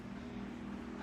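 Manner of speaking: long, soft sigh. Acted. Boy about to visit his friend, quiet sigh. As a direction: let out a quiet breath.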